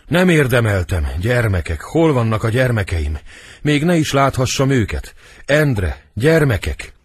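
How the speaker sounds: impassioned dying lament; acted dying anguish. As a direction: call desperately for the children. Dying queen calls for her children.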